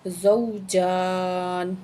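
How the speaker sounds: زوجان with the ja held one alif long and a firm za; زوجان slowly, za pronounced firmly; elongated zawjan. Shown as a demonstration. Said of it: "ایک الف کے برابر کھینچ کے پڑھیں گے".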